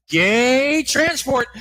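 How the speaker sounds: slow, drawn-out ad title; ad announcer. Acted. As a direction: announce it like a cheesy commercial. Fake ad title read slowly.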